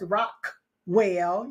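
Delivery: Rockwell with a hard, released K before "well"; K pronunciato. Shown as a demonstration. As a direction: split Rockwell after an overdone K, very slowly. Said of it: "pronounce all of these names with the K's".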